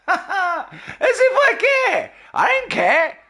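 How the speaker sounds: high-pitched, laughing taunt; mocking squeal. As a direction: squeal your mock indifference. Mocking complainers who expect care.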